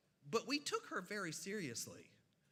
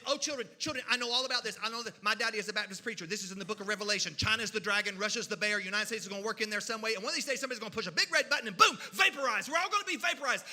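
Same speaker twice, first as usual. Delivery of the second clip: louder, faster doomsday sermon; teacher preaching Revelation to her class; worked-up preaching; 2 semitones higher, 6 dB louder, faster than usual. Retold or imitated. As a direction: build to the boom like a fire-and-brimstone teacher.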